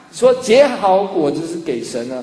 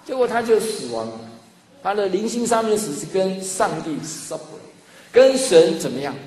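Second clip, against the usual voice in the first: ordinary talk, nothing marked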